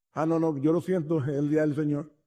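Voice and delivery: low, soft brush-off; dismissive excuse. Acted. Churchgoer turns down neighbour whose house is burning, dismissive excuse. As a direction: excuse yourself quietly, playing an uncaring churchgoer.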